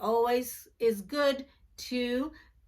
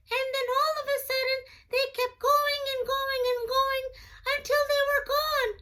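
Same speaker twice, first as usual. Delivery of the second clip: very high squeaky puppet voice, quick; a tiny excited puppet friend; high cartoon puppet chatter; 13 semitones higher than usual. Puppet Jackson talks after story.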